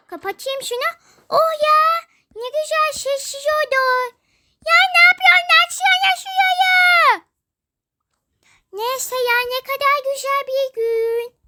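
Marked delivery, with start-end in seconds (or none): 4.7-7.2 high, loud crying shout; wailing shout. Acted. Locked-out child wails at friend to open up. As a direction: howl to be let in. Shut out, demands opening.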